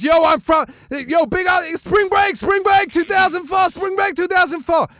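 high, loud spring-break yelling; pumped-up frat boy. Retold or imitated. Wrestler giving a shout-out, high hyped shouting. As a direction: imitate a party boy shouting to camera. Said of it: "He goes".

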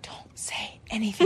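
breathy hushed warning; whisper. Retold or imitated. Mom whispering to other moms not to tell husband. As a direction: hiss it so no one overhears. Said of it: "unvoiced whisper".

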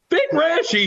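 startled echo. Retold or imitated. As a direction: bounce the name back in shock. Echoing Aaron's outburst in surprise.

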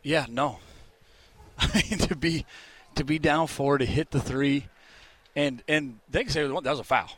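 breathless and winded after climbing stairs; puffing, out of breath between words. Real, not acted. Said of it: "sorry, I'm out of breath walking up these stairs".

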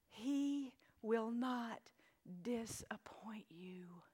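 very slow, fading to a whisper. Acted. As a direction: draw it out softly and firmly. Slow emphatic close.

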